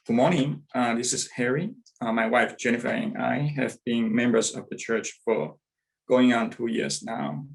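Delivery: normal talking voice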